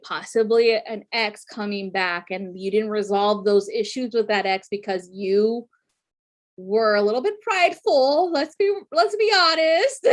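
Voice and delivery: usual speaking voice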